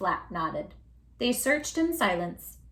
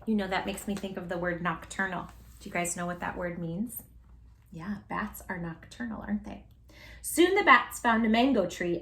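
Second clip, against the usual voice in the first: ordinary delivery, nothing marked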